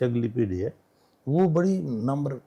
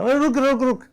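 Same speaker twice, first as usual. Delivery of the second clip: much higher, loud call to stop; 11 semitones higher, 4 dB louder than usual. Retold or imitated. Man calling after a retreating police inspector, loud. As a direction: shout after someone walking away.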